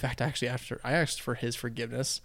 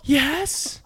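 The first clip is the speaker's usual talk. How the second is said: put-on much higher little kid yes; a tiny kid eager to play; eager toddler; 7 dB louder than usual. Toddler brother eagerly agrees to play hide and seek, high kid voice.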